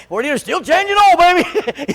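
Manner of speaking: wife calling out to husband hiding in the garage. Retold or imitated. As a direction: pitch it up like his wife calling from the house.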